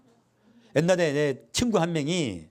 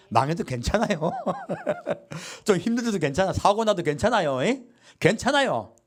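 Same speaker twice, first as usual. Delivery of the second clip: higher, laughing reassurance; 7 semitones higher than usual. Shown as a demonstration. Pastor modeling real comfort to the congregation, laughing. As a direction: cheer them up with a laugh. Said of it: "진짜 위로는 뭐예요?".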